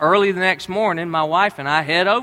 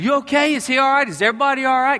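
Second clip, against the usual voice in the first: higher, urgent worried questions; freaked out; 7 semitones higher, faster than usual. Retold or imitated. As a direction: fire them off like a scared dad. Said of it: "I freaked out".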